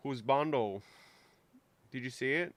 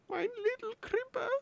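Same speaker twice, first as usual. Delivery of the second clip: tiny, very high squeal; squeaky cooing. Pretend. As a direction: coo it in a squeaky little voice. Cooing at a little crimper.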